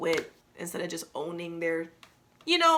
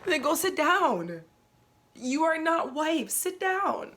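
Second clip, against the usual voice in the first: mock self-scolding; 8 semitones higher, slower than usual.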